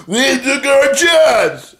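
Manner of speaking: slow, very high caricature; mock outburst. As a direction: stretch it out in falsetto. Husband mockingly retold chasing Garza off.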